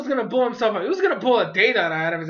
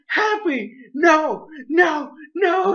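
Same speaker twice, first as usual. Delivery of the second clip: high, louder, repeated desperate no; desperate shouting; 7 semitones higher, 4 dB louder, much slower than usual. Retold or imitated. Reviewer yelling at the screen as Happy flies off, desperate shout. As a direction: yell at the screen in despair. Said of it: "And I was like".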